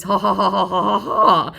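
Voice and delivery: mock delusional cackle; imitated cackle. Imitating the scammer's delusional cackling for viewers. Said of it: "this delusional cackling that he does".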